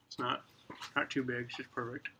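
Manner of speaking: talking around pipe stem; pipe in mouth. Real, not acted. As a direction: teeth clenched on pipe. Seen 4: Pipe stem clenched in teeth from 1.4s; hands only before.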